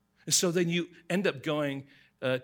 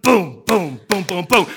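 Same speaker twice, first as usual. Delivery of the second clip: loud chanted drum booms; drum beat imitation; 5 semitones higher, 16 dB louder, slower than usual. Mimicking the stadium drum after the chant, loud booms. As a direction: pound out the booms like stadium drums.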